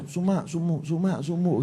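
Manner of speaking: soft mock mumble, barely voiced; mumbled under breath. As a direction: mimic someone wishing silently. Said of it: "Orang pengen kok batin saja".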